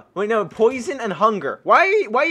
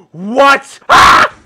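startled scream; 5 semitones higher, 12 dB louder than usual. Real, not acted. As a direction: gasp then high loud shriek. Mutant creeper appears.

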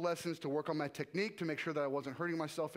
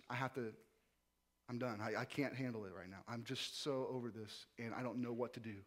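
despairing; 6 semitones lower, 7 dB quieter than usual. Retold or imitated. Despairing text to Levi.

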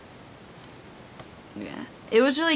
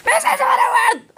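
loud high call of the dog's name; playful shout; 9 dB louder than usual. Retold or imitated. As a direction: yell it right in her face. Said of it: "I was like".

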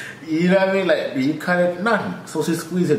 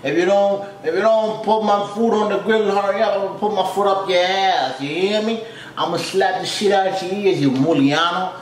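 higher, hammed-up Italian New York accent, ordering and threatening; Italian-American tough guy; 6 semitones higher than usual. Imitating an Italian-American customer bossing the cook, hammed-up accent. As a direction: play a mob-movie Italian guy yelling his order. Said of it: "That's how they talk".

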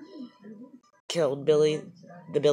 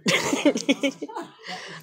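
laughing; 11 semitones higher than usual. Real, not acted. Wife laughs.

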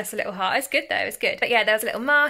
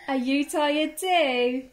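normal talking voice

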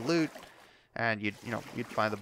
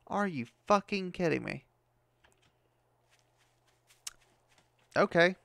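exasperated anger; 5 semitones higher than usual. Real, not acted. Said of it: "I am very pissed".

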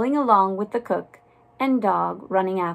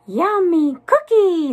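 high, loud, drawn-out delight; greedy little mouselings' cheer; crying out; 9 semitones higher, 7 dB louder than usual. A narrator's reading. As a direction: squeal it slowly, hungry for the treat. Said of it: "they cried".

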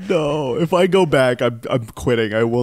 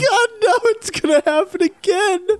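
anguished wail; 4 dB louder than usual. Real, not acted. Gamer dreading failure, crying out.

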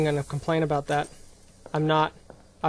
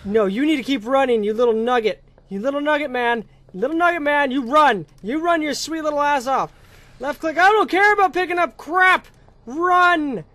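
high, loud frantic urging; frantic yelling; 7 semitones higher, 6 dB louder than usual. Real, not acted. Gamer frantically yelling at her character to run. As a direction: yell at your character to run.

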